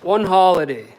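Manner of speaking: barked out loud, punching ONE; angry shout. Real, not acted. Scolding his family, a loud "One holiday".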